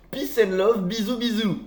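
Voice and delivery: high, loud, sing-song hippie voice; mock hippie. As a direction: chirp it like a blissed-out hippie.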